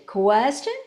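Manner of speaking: "Question" lifting at the end; rising question. Shown as a demonstration. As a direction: say "Question" as if asking. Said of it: "Questions go up".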